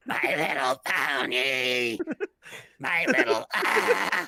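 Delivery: slow, distorted cartoon jingle rising into noise. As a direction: imitate a broken television theme.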